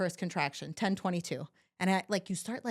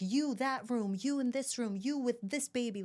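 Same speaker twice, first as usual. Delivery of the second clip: doctors giving orders; 5 semitones higher than usual. Retold or imitated. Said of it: "They're like".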